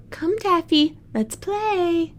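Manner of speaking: put-on high, sing-song child's call; little girl calling her cat. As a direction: coax the cat over to play. Child calls the cat to play.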